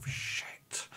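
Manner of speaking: hushed, voiceless swear in character; whispered curse. Acted. Driver cursing as the passenger takes the wheel, whispered. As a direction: let the curse out under the breath. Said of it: "like that".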